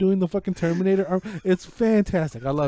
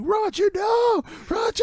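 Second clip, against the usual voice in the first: high frantic yell, slow on the no; yelling for Roger; slower than usual. Retold or imitated. Man yelling for his teammate like a sports fan. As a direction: cheer like a desperate sports fan. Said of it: "the way he was yelling for him".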